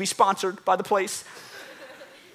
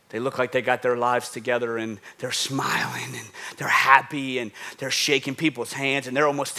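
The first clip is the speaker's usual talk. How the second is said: hushed aside; 10 semitones lower, 6 dB quieter than usual. Acted. Preacher describes smiling churchgoers in a hushed whisper. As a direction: breathe the words out like a secret.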